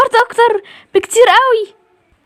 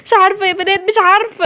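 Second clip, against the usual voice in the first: ordinary delivery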